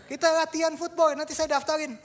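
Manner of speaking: high, loud eager offer; captain signs up new boy, high and eager. Retold or imitated. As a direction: sell it with eager energy. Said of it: "captain talking".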